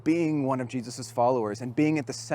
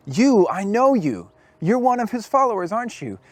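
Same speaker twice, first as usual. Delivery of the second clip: loud accusation; 7 semitones higher, 7 dB louder than usual. Retold or imitated. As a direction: call him out for the final time, louder. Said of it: "And a third time".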